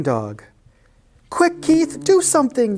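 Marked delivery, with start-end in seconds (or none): 1.3-2.8 high, urgent plea; alarmed, pleading squeal; panicked cry. A narrator's reading. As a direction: cry for help in a high cat voice. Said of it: "they cried".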